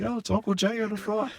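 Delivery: put-on excited small child calling out; little kid voice. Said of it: "And I'd be like".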